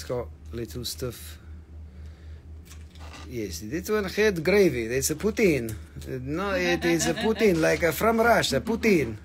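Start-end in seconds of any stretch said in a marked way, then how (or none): 3.3-9.2 hammed-up, badly faked French accent; clumsy mock-French accent, extra vowels after words. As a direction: attempt a French accent that drifts toward Borat. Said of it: "my French accent is very bad".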